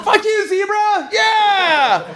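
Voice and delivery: high-pitched mock crowd cheer; overeager heckling. Nerdy teen tries to fit in at the game, mock heckling cheer. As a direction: whoop along with the crowd, hammed up. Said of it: "trying to be part of the, the fun".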